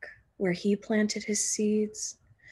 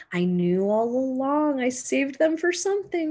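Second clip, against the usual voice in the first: a lightly voiced pleased old neighbor, higher; neighbor's proud voice; 5 semitones higher, 3 dB louder than usual. Mister Bennett to the kids, a lightly voiced proud neighbor.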